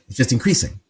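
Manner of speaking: usual speaking voice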